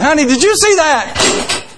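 high, drawn-out call to spouse; excited calling out. Retold or imitated. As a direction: call down the hall, high and slow.

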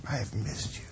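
soft, tender and hushed; tender whisper. Acted. Pastor voicing God to a wandering believer, soft and tender. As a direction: say it gently, like missing a loved one. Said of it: "He would say it in this way".

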